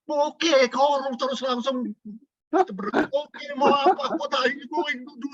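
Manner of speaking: high-pitched mimicry of an obliging voice assistant; eager assistant. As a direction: answer way up high, like a gadget suddenly obeying. Retells Google eagerly obeying his wife. (meniru asisten suara, nada melengking tinggi)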